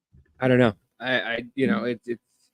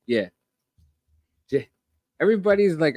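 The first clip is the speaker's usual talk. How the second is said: usual speaking voice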